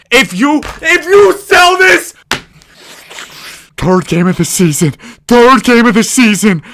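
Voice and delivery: high, loud furious yelling; furious rant. Real, not acted. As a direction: scream in real rage at the game. Raging after a game loss.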